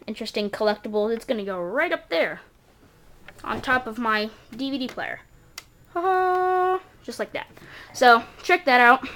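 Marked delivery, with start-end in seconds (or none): 5.9-6.8 high, loud gleeful ha ha; triumphant laugh. Real, not acted. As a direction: crow with delight. Triumphant after placing collectible.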